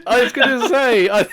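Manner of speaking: high laughing words; can't stop laughing. Real, not acted. Friend laughing while telling the story.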